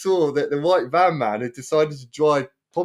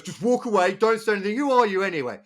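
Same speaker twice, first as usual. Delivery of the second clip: imitating an irritated driver, pitched up; mild mock anger; 6 semitones higher than usual. Van driver tells the onlooker to walk away, mildly angry imitation. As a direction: brush him off in a higher, mock-angry voice.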